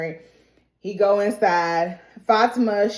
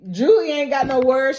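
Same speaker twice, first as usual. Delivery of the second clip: ordinary talk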